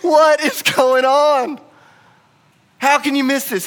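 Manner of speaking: high, drawn-out exasperated shout; losing his mind. Acted. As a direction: wail it in disbelief, loud and slow. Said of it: "at times he just lost his mind".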